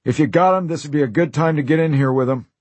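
urgent call. Acted. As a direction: hurry them in, pressing. Gangster urging from doorway.

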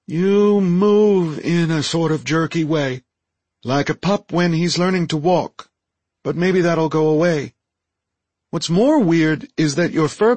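high alien creature voice; high-voiced Deltan, candid. Deltan critiques the android's movement and fur, high candid voice.

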